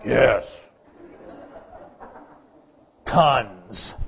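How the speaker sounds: mock-weary groan, each word dragged out; grudging older kid. Grown-up child asked about homework, grudging. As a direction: drag out a put-upon complaint.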